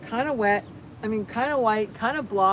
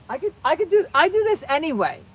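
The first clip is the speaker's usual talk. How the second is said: bursting, high and loud with delight; real excitement, pitched high and loud; excited gush; 9 semitones higher, 10 dB louder than usual. Real, not acted. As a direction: spill it out, thrilled. Gushing about her crafting hobby.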